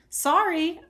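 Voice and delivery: quick shouted sorry; shout over shoulder. A narrator's reading. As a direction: fling it back over your shoulder while running. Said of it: "I shout over my shoulder".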